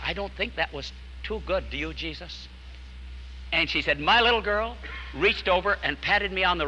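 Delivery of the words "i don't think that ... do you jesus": much softer, gently addressing the empty chair; said very quietly. Retold or imitated. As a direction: drop the voice right down as if beside Jesus at the table. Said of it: "and very quietly I said".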